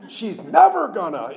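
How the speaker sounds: NEVER punched high and drawn out; slow, exaggerated stress on never; ego's complaint. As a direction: hammer "never" in mock grievance. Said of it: "that's definitely the ego".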